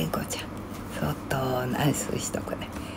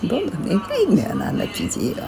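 quick, higher, exasperated griping; fast irritated rant; 6 semitones higher, 4 dB louder, much faster than usual. Real, not acted. Venting about her old husband, fast and fed up. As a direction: rattle it off like you're fed up.

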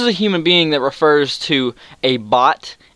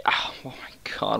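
breathy gasp; 3 semitones lower, 12 dB quieter than usual. Real, not acted. Gasping at the post.

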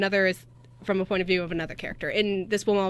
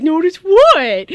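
high, slow, loud puzzled question; drawn-out mock-clueless reply; puzzled Christina; 7 semitones higher, 11 dB louder, much slower than usual. Imitating cohost asking what she should notice, high and slow.

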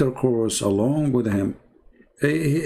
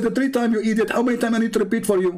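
尖声急促地模仿对方; 高音快速不耐烦; impatient caller, very high, fast; 9 semitones higher, much faster than usual. Retold or imitated. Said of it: "He said".

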